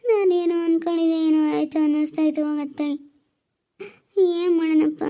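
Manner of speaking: weeping despair; crying. Acted. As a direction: wail helplessly through tears. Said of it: "ಅಳ್ಬೇಡ, ಸಮಾಧಾನ ಮಾಡ್ಕೊ".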